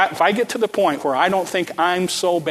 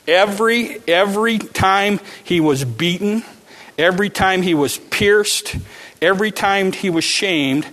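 building preaching; 4 semitones higher than usual. Acted. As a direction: drive each every home from the pulpit.

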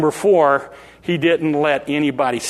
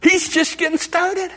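excited; 12 semitones higher than usual. Real, not acted. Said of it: "repeated after laugh".

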